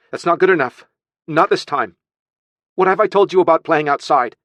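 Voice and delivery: high, loud telling-off; stern scolding. Acted. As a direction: scold the child firmly. Aunt scolds boy.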